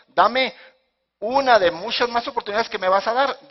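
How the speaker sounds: high, loud, confident asking; emphatic request. Acted. What Samson should have asked God, loud and emphatic. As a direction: ask boldly at full voice.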